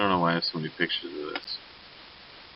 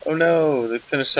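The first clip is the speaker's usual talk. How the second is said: dismayed; 8 semitones higher than usual. Real, not acted. Archer unit wiped out.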